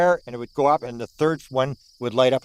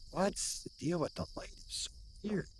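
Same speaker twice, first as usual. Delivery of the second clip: soft, wondering whisper; puzzled whisper; 5 semitones higher, 12 dB quieter than usual. Retold or imitated. Staring at the glowing orange light, puzzled whisper. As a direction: whisper it, baffled by what you see.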